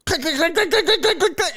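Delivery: rapid clicking mouth noises; mimicked camera clicks. Comic imitating the dad snapping photos, clicking sounds.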